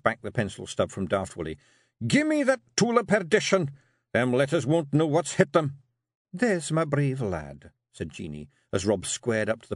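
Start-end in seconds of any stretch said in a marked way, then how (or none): 2.1-5.8 high, louder Scots battle bluster; defiant Rob. Acted. Rob's voice. 6.3-7.7 slow Scots wifely approval; pleased and proud; Jeanie praising. Acted.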